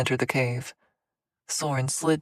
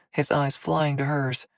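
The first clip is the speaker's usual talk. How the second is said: plain reading voice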